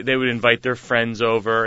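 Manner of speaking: ordinary talk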